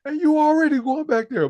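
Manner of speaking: high, slow mock-scolding mama; imitating the mother. Retelling the mother scene, high mock-scolding mama. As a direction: nag him in a high pleading voice. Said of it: "It's like".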